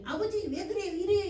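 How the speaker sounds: put-on whiny child. Children whining to father.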